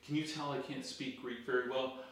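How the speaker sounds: ordinary delivery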